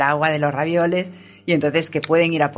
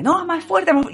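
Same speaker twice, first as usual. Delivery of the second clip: high, pushy call for more; insistent director; 12 semitones higher than usual. Retold or imitated. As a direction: demand it high and urgent. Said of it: "Y ella más fuerte".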